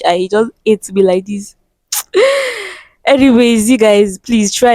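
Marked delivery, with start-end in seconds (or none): 1.9-2.7 high, short rueful laugh. Real, not acted. Mocking her own bad luck, a rueful laugh.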